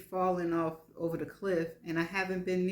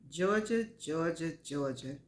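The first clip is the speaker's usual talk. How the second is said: Stan holding her, savoring her name; tender repeated name. A narrator's reading.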